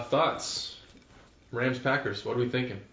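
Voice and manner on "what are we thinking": ordinary talk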